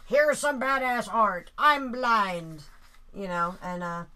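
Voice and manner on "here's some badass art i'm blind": loud, higher-pitched bravado; Tommy boasting. Retold or imitated. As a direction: belt it out, slow and high. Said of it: "and he's like".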